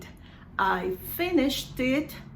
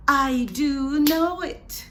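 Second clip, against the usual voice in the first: slow, loud, raised DO; emphatic DO; 4 semitones higher, 5 dB louder than usual. Shown as a demonstration. Teacher demonstrates emphatic affirmation, DO loud and stretched. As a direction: insist with a drawn-out, punched DO. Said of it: "That's for emphasis".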